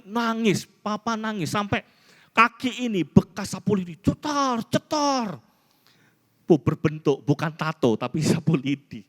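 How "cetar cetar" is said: high-pitched mock lash of a broom; imitated whipping sound. Son recalling mother's broom lashes, high imitated cracks.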